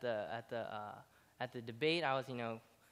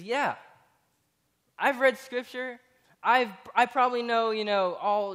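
confident bragging; 7 semitones higher, 7 dB louder than usual. Retold or imitated. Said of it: "he says".

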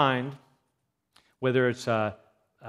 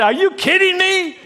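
high, loud exasperated outburst; mother snapping at her daughter; lost it; 12 dB louder than usual. Retold or imitated. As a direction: blurt it out in disbelief, much higher and louder. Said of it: "the mother just kinda lost it".

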